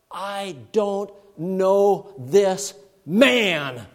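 very slow, drawn-out denial; slow emphatic denial. Retold or imitated. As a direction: drag out each word of the denial. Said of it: "curses and swears in a big way".

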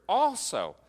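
"also" thrown out high and loud; stress on ALSO. Shown as a demonstration. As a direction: punch "also" as the key word. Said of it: "it emphasizes the word".

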